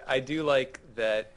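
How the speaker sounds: ordinary talk, nothing marked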